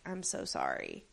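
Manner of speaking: soft, low, barely voiced apology; whispered sorry. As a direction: breathe it out, heartbroken. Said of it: "And she's like".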